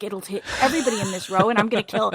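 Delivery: retelling an irrational terror, fast; panicked thought. Retold or imitated. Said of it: "I was like".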